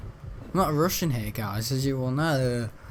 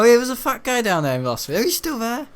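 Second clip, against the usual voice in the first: excited; 10 semitones higher, 5 dB louder than usual. Real, not acted. Excited high voice.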